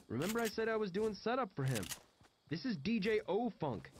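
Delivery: high-pitched, chatty character voice; John, casually explaining. Acted. John introduces the DJ he worked for, high and chatty.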